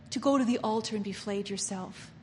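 ordinary talk

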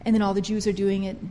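ordinary talk, nothing marked

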